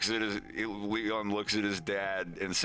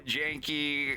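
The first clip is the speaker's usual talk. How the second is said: higher, halting bad Polish; horrible Polish accent; 8 semitones higher, much slower than usual. Wolf greets Janusz in Polish, horrible accent. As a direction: stumble through badly accented Polish. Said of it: "in a horrible accent".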